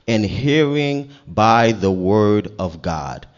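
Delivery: loud, forceful, deliberate preaching; preaching scripture. Acted. Preacher teaches that faith comes by hearing, loud emphatic preaching. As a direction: drive the verse home to the congregation.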